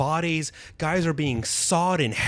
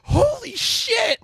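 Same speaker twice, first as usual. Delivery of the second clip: holy shit; much slower than usual. Retold or imitated. Acted ant reaction.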